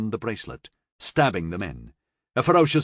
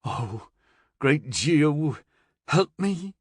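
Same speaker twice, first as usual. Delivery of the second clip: moaning; 5 semitones higher, much slower than usual. Acted. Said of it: "Florian moaned".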